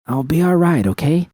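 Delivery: pleading with V to drop it. Acted. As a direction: plead for her to let it go. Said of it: "I pleaded".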